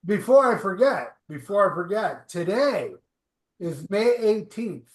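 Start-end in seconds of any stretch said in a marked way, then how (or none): none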